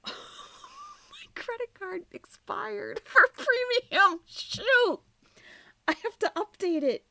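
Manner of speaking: high, loud laughing dismay. Real, not acted. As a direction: laugh in flustered panic. Discovers expired card, laughing.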